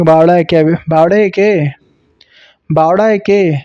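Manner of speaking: बावड़ा with a Rajasthani sound halfway between ल and ड़; mock Rajasthani, the l curled back in बावड़ा; Rajasthani retroflex la. Shown as a demonstration. Teacher imitates Rajasthani speakers saying बावड़ा to the class. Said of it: "ना ही स्पष्ट ड़ बोल रहे हैं, ना ही स्पष्ट ल".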